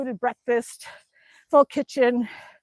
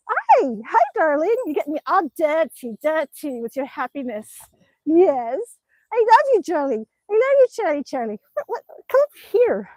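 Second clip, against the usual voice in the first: cooing at dog; 3 semitones higher than usual. Real, not acted.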